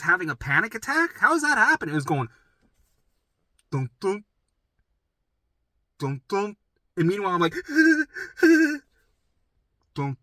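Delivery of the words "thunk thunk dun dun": imitated heartbeat.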